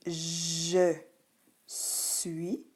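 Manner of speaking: "je suis" very slowly, final s mute; word-by-word "je suis"; silent S. Shown as a demonstration. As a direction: draw out "je", pause, then "suis" without the last s. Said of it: "le S est muet".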